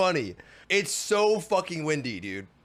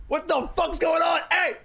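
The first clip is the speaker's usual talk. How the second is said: angry shout; 8 semitones higher than usual. Retold or imitated. Fighting the performer for the twenty, shouting. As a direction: yell at her over the bill. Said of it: "literally doing this".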